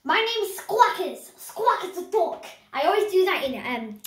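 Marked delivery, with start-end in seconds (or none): 0.0-2.5 high, slow cartoon duck. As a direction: squawk it out like a duck. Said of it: "I can do the proper duck voice".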